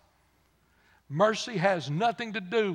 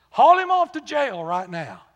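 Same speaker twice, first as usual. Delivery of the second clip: loud, high, harsh order to jail him; angry demand; 8 semitones higher, 14 dB louder than usual. Retold or imitated. Creditor demanding the debtor be jailed, shouted loud and high.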